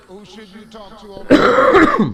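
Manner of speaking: loud host shout. Acted. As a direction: bellow the question at the crowd.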